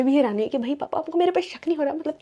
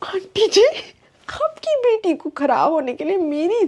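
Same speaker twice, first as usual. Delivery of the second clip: indignant call; 6 semitones higher, slower than usual. Retold or imitated. Talking back to the aunt.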